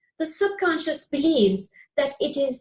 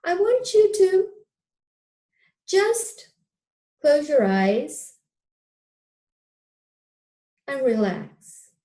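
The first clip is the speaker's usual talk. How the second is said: hypnotic guidance. Acted. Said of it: "I will guide you, uh, with a, uh, with a, um, hypnotic, uh, N L P style guidance".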